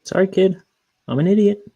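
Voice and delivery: high-pitched mock apology; sheepish apology. Uncle letting the kid down, sheepish high voice.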